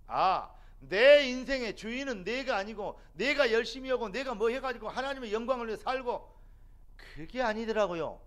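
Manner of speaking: loud, slow, rising preacher's plea; fervent preaching. Acted. Preacher pleading with the congregation, loud and slow. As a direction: press the point home to the congregation.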